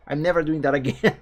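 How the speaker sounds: amused, laughing protest. Real, not acted. Laughs recalling failed accent attempt.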